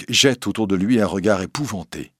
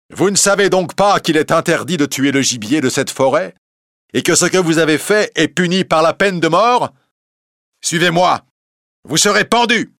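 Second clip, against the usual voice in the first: évêque sévère, voix plus haute et plus forte; menace-les comme un évêque cruel; évêque menaçant; 10 semitones higher, 6 dB louder than usual. A narrator's reading. L'évêque menace Robin de pendaison. (l'évêque menace Robin de la pendaison, voix sévère)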